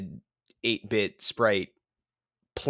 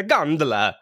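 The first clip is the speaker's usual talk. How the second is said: hammed-up Italian gondola, like a yelp; mock Italian accent; 4 semitones higher, 5 dB louder than usual. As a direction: overdo the Italian like a pretentious tourist. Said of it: "You sound like ... stepped on a dog's toe".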